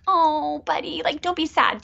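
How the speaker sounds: high-pitched parental "poor buddy" voice; cooing consolation. Acted. Parent fussing over a sad child, cooing consolation. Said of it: "sad was like".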